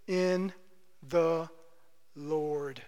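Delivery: "in the Lord" spaced out, each word alone, falling low and soft; slow emphatic phrase. Shown as a demonstration. Pastor naming the doctrine, slow and low. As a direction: lay down "in", "the", "Lord" one by one, sinking. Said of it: "That is a doctrine".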